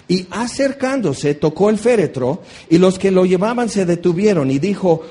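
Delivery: ordinary delivery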